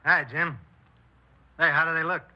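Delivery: loud, bright hail across the booth; barfly calling out to a friend, loud and cheery; hearty greeting. Acted. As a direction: greet him big and breezy. Bill greets Jim loudly.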